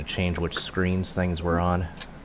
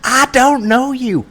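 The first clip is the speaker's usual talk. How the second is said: mock indignant shout; 8 dB louder than usual. Echoing the purse-snatch meme, yelling.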